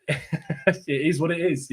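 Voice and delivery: laughing, slow attempt at a Cockney accent; self-mocking Cockney accent, slowed down. Shown as a demonstration. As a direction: drop into your best Cockney, half-laughing. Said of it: "in the most Cockney accent I've got".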